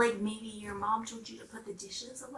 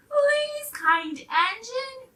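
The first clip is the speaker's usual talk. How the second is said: high, loud begging plea; pleading dolls; 14 semitones higher, 7 dB louder, much slower than usual. Acted. Dolls begging the old engine for help, high pleading. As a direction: beg the engine like tiny desperate toys.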